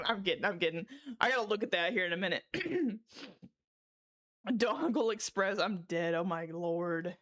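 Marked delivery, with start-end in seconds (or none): none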